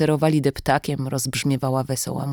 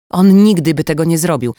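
louder, snapping impatience; irritated; 3 semitones higher, 8 dB louder than usual. Acted. Kidnapper losing patience with Sasza, louder and irritated. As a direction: cut her off, annoyed. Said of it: "zirytowała się kobieta".

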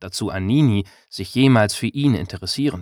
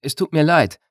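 stammering; 6 semitones higher, 3 dB louder than usual. A narrator's reading. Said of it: "stammelte er".

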